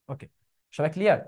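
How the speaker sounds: usual speaking voice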